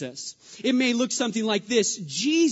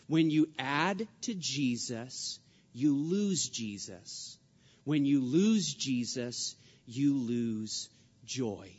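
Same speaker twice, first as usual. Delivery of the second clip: slow, lower and measured; slow, deliberate emphasis; 6 semitones lower, 4 dB quieter, slower than usual. Real, not acted. Preacher driving home Paul's warning, slow and deliberate. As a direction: drive the point home slowly, softening.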